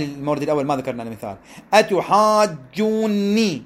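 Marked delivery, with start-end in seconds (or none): 1.7-3.6 أتحاجوني slowly, with the long vowels drawn out; stretched madd. Shown as a demonstration. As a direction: draw out the alif and the waw in أتحاجوني. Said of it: "السيد أتى بكلمة فيها ثلاثة أمثلة".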